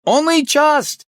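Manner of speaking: high, loud shouted reply; shouting. Acted. Chief barely hearing the inspector, shouting. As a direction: shout back down the crackling line.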